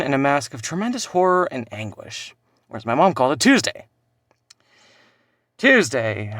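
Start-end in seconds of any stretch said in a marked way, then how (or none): 3.4-3.9 high, loud punch on one word; punchline. Acted. Son lands the joke about his mom, loud punchline. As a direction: hit the joke hard, pitched way up.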